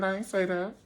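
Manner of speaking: high denial. Retold or imitated. Jamie denying, high voice.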